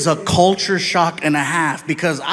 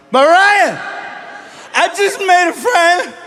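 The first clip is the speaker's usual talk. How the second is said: put-on drunk childlike plea; 9 semitones higher, slower than usual. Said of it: "I'm like".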